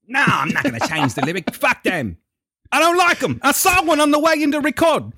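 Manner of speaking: hammed-up Australian rocker, rising high in protest; attempted Aussie accent, indignant; bad Australian accent. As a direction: play a stubborn Australian singer, badly faked accent. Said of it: "I couldn't do an Australian accent".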